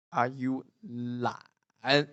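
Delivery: slow "lan" dipping then rising, like a question; third-tone tune. Shown as a demonstration. Teacher fits lan into the question, slow dipping tone. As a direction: stretch "lan" down and up after "Are you". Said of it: "the third tone".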